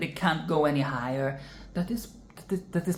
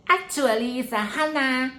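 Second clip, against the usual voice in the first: mock know-it-all weeb, pitched up; 7 semitones higher than usual. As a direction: correct them like a smug anime fan. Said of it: "if you're a weeb, you would be like".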